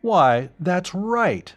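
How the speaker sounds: a sudden realisation; exclaimed. A narrator's reading. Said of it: "Professor Kelton exclaimed".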